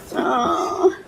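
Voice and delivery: usual speaking voice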